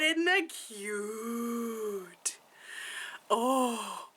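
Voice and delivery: adoring coo. Real, not acted. Showing off a cute find from work, adoring coo. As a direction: coo over it, stretching it slowly.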